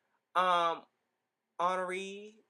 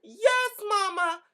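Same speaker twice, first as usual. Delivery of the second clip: excited cheer; 11 semitones higher, 5 dB louder, slower than usual. Retold or imitated. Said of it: "I said".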